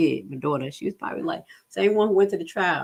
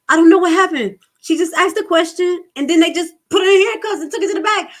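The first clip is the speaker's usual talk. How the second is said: put-on high, loud distressed girl's voice; much higher and louder frightened daughter; upset daughter; 12 semitones higher, 9 dB louder than usual. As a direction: play the scared child pleading.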